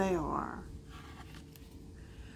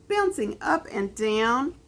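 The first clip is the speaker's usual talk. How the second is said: usual speaking voice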